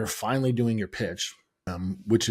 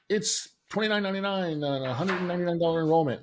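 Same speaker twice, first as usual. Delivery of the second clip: front desk clerk; 8 semitones higher, faster than usual. Retold or imitated. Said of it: "the front desk would be like".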